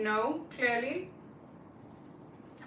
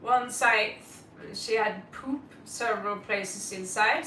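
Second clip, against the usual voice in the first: ordinary talk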